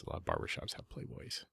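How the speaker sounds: hushed, breathy aside to the group; whispered aside. Real, not acted. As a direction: drop to a whisper for the joke. Teasing aside about barbershops.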